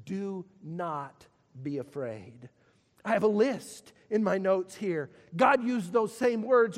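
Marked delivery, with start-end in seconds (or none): 0.1-2.5 low, slow, soft and tender; soft reassurance. Acted. God waking Paul at night, softly comforting. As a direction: breathe it out gently like a secret.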